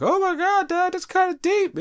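put-on high squeaky kid voice; mocking his kids. Telling fans how his daughters react, in a high mocking kid voice. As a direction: play a stunned little girl, way up high. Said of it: "they be looking like".

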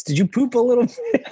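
put-on high sing-song baby talk. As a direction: coo it like talking to a toddler. Cooing at adult like a baby.

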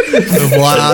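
Vlad said in a mock Scottish accent, laughing; bad Scottish accent. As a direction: fumble Vlad in a Scottish brogue. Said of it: "hard to say Vlad with, like, a Scottish accent".

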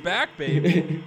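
loud, high, drawn-out cheer; playful hype. Pretend. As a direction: stretch it out like a rowdy fan. Hyping the Eagles' return.

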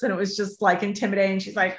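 usual speaking voice

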